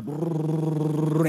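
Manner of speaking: long rolled "brrr" of drums; imitated drum roll. Preacher imitating the church drums starting up, rolled drum noise. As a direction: trill a drum roll with the mouth. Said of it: "the drums would go".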